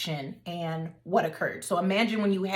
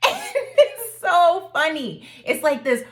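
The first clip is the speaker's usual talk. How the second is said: laughing excitedly; 8 semitones higher, 7 dB louder than usual. Real, not acted. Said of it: "laughing recounting".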